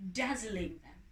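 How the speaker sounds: ordinary delivery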